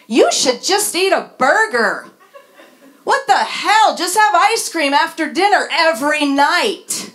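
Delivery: a hammed-up clueless friend; mock helpful advice. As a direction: toss off the advice like it's obvious. Mock advice to eating disorder.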